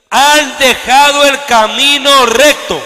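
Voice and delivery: slow, loud scripture reading; lectura enfática. Acted. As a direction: read the verse out with weight. Reads scripture with force. (lectura bíblica lenta y fuerte)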